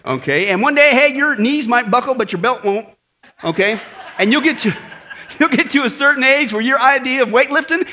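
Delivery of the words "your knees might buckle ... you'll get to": ordinary delivery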